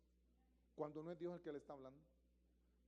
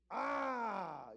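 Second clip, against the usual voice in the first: loud demonic ah; loud startled demonic ah; scandalized demon cry; 4 semitones higher, 7 dB louder than usual. Acted. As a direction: cry out like an alarmed spirit. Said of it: "lo dijeron así medio escandaloso".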